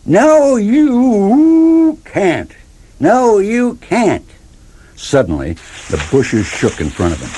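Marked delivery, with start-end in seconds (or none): 0.1-4.2 high howled answer, slowing at the end; answering dog howls, high and drawn out; distant howls. A narrator's reading. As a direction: howl back from far away like dogs in the night. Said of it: "The other dogs answered in the distance".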